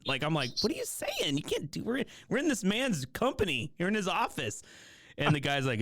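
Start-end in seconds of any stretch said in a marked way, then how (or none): 0.6-4.6 pitched way up, urgently telling a friend off; a startled don't-embarrass-us plea, high; half-whispered shock at a student's blunder; alarmed disbelief. Retold or imitated. Hushed scolding of snarky student.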